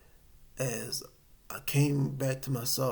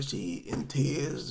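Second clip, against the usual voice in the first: ordinary delivery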